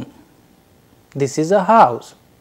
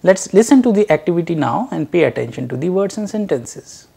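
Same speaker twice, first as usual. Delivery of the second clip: ordinary talk, nothing marked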